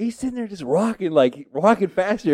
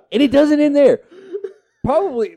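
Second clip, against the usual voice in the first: ordinary delivery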